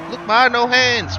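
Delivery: slow, high, playful mock kid voice; showing off kid. Driving one-handed in the game, a slow high mock boast. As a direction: ham it up like a kid showing off to mum.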